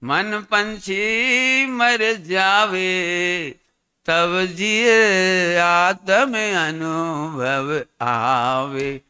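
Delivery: drawn-out, chanted line of verse; slow verse recitation. Acted. Preacher lovingly reciting a line of devotional verse, very slowly. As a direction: savour each word of the couplet.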